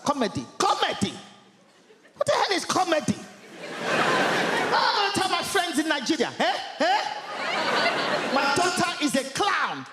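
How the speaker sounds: scandalised mother shouting; outraged mum. Retold or imitated. Mum outraged at comedy career.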